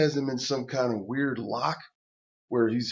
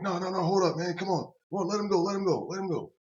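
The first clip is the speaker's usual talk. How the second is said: higher, urgent plea; pleading; 7 semitones higher, faster than usual. Retold or imitated. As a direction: beg him to let go. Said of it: "I'm like".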